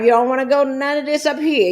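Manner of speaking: ordinary talk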